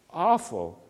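ordinary talk, nothing marked

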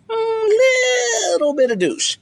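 high held "Mm", then "little" stretched high and falling to "douche"; imitated wife, drawing out "little" in a high pitch; exaggerated stress. Wife answering "was I an asshole", high teasing stress on "little". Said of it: "you gotta say it like that".

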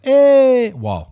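"awol" said high and loud with Italian flair; Italian-style awol. Retold or imitated. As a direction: stretch "awol" like an Italian word. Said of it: "It's probably like this".

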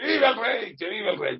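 vítor de borracho, agudo y arrastrado; borracho vitoreando. Retold or imitated. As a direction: grita como un borracho que festeja al rey. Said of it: "hay unos borrachos ahí que dicen". (imitando a un borracho que grita viva)

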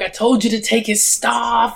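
high, mock-exasperated movie villain; Brad Dourif. Villain scolding guards over the wizard's staff, exasperated. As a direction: imitate an outraged henchman's line delivery. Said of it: "The magical line delivery from Durif".

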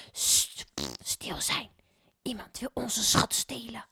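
urgent hushed whisper. A narrator's reading. Mevrouw Poepies hushing the neighbour, urgent whisper. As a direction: hush them, someone is near. Rustling in bushes.

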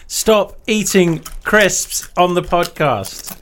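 slow, word-by-word reprimand; drawn-out, emphatic telling-off; exasperated scolding. Real, not acted. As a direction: spell out the order to naughty co-hosts. Scolds co-hosts crunching on air.

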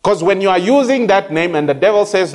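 normal talking voice

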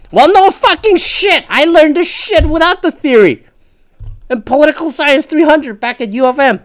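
high-pitched, loud exasperated yelling; indignant outburst. Real, not acted. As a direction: blurt it out at the top of your pitch.

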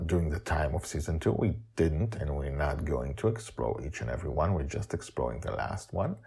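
ordinary delivery, nothing marked

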